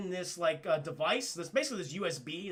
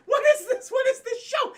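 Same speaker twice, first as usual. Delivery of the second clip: very high, loud squeal of disbelief; incredulous outburst; 10 dB louder than usual. Real, not acted. Reviewer reacting to a cartoon execution, incredulous high squeal. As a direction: shriek it in comic disbelief. Incredulous at the show's dark turn.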